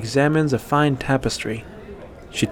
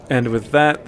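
plain reading voice

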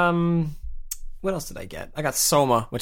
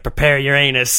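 ominous mock warning; 4 semitones higher, 7 dB louder than usual. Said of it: "just like".

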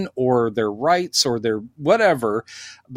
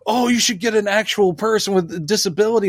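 mocking complainer; 6 semitones higher, faster than usual. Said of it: "they're like".

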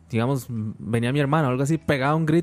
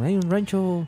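Guanacaste accent; 5 semitones higher, slower than usual. Shown as a demonstration. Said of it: "tiene que hablarlo así como".

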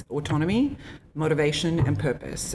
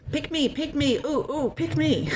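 much higher, pleading pick me; overeager; 13 semitones higher than usual. Real, not acted. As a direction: bounce like a keen schoolkid. Eagerly volunteering to start.

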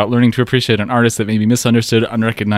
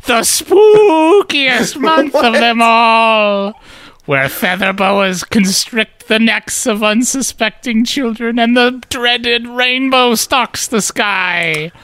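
high, slow spooky ghoul voice; jack o lantern voice; 12 semitones higher, slower than usual. Acted. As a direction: drawl it out like a Halloween crypt keeper. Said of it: "earlier reader: jack o lantern voice".

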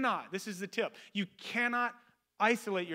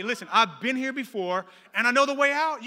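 loud, raised preaching delivery; building to a high, forceful peak; emphatic exhortation; 5 semitones higher, 8 dB louder than usual. Acted. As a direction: drive it home to the congregation. Preacher repeats line as exhortation.

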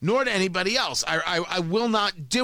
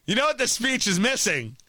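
mock eager speechwriter; 4 semitones higher than usual. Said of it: "I have not yet found the place like".

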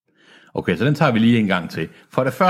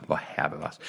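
ordinary delivery

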